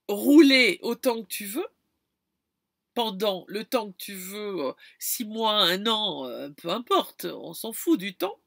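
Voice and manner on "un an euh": usual speaking voice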